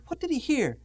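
normal talking voice

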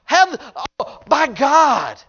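mock demon begging for mercy; desperate begging. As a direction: plead desperately with God. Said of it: "And he says".

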